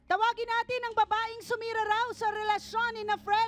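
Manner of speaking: loud, high host announcement; announcing. Real, not acted. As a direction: call the guest in with flair. Host calling guest onstage.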